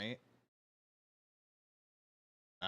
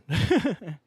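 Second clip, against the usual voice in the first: loud laugh; 8 semitones higher, 14 dB louder than usual. Real, not acted. Laughing with friends after his rant. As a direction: burst of laughter.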